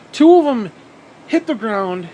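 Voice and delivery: sudden excited spike; excited burst. Real, not acted. Excited retelling.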